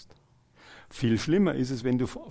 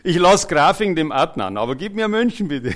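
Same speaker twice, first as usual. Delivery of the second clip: louder, higher pleading with a laugh in it; eager mock plea; 5 semitones higher, 9 dB louder than usual. Preacher playing a believer bargaining with the Lord, playfully pleading. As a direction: bargain cheekily for the big prize.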